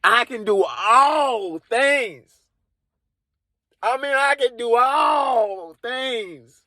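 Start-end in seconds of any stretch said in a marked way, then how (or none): 0.0-2.3 slow, solemn holy pronouncement; solemn Jesus voice. Retold or imitated. Jesus answering the comedian, slow solemn intoning. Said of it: "He's like". 4.1-6.6 slow, solemn holy pronouncement again; solemn Jesus voice. Retold or imitated. The comedian repeating Jesus, slow solemn intoning. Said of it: "I'm repeating it twice".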